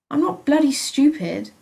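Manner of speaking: loud, high snapping retort; angry retort. A narrator's reading. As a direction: throw it back at her hard. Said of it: "I retorted".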